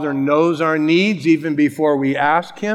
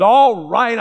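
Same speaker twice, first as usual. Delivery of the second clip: higher, louder fed-up sigh of words; irritated outburst; 7 semitones higher, 5 dB louder than usual. Retold or imitated. Sleepy neighbor giving in to the pest, louder and irritated. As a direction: give in with a grumpy snap.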